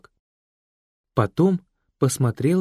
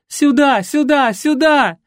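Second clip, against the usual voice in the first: high, loud repeated cries; frantic shouting; 14 semitones higher, 8 dB louder, slower than usual. Acted. Лена shouts back frantically from the yard. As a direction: shout desperately for help. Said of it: "раздалось в ответ".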